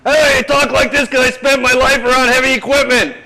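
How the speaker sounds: high, very loud shouted imitation; booming mock shout of a heavy-equipment guy; loud-talking construction guy. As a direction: holler like a man used to talking over heavy machinery. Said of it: "And he talks like this".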